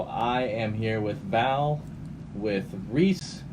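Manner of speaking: ordinary talk, nothing marked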